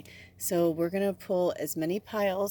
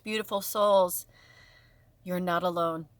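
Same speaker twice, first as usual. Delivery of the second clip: ordinary delivery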